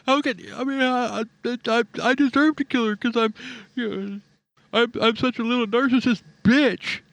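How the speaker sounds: high, whining sulky man voice; whiny mocking voice. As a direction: whine it like a spoiled brat.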